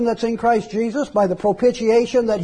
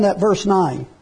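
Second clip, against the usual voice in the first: ordinary talk, nothing marked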